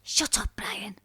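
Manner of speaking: hushed whispered rebuke. Real, not acted. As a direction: hiss it softly to hush him.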